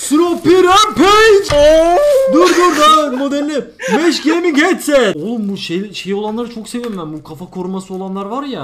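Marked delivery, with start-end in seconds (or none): 0.0-5.2 loud, high, drawn-out product cry; over-hyped reveal. Pretend. Streamer revealing the sponsored headset, over-hyped shouting. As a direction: belt out the product name slowly.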